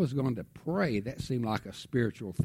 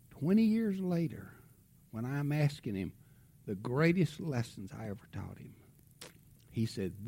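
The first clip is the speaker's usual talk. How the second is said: usual speaking voice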